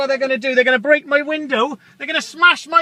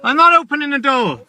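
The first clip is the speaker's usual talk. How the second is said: usual speaking voice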